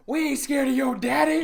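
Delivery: put-on high, loud defiant kid; defiant shout. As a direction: yell it back defiantly. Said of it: "he said".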